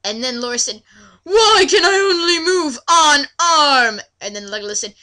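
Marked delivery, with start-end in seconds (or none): 1.2-4.0 high, loud, slow mock-tearful whine; hammed-up weepy question. Reading Laura's line to a friend, mock-tearful and slow. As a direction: whimper like an overdone patient.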